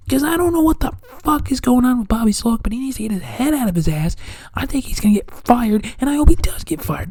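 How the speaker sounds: usual speaking voice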